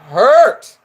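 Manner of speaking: hurt punched loud; stressed hurt. Real, not acted. Vlogger stressing how much it hurt. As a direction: lean hard on hurt.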